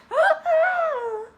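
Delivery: very high delighted squeal of laughter; excited squeal. Acted. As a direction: squeal with joy.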